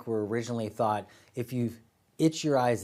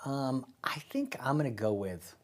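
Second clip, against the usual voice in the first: whispering; 3 semitones higher than usual. Real, not acted. Hesitant answer after big sigh.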